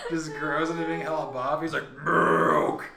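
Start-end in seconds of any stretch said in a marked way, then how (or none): 2.0-2.8 loud monster bellow; Hulk. Acted. Hulk, fully grown, calls for milk, loud mock roar. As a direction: roar it out like a smashing brute. Hulk's catchphrase twisted into milk.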